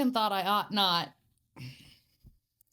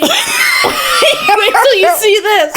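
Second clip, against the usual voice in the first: slow high words through laughter; cracking up mid-sentence; laughing; 13 semitones higher, 6 dB louder, much slower than usual. Real, not acted. Laughing while telling.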